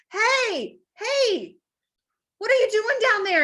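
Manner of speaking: high, calling down into the pit; passerby calling down. Retold or imitated. Passerby calls down to the man in the pit. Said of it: "and he's like".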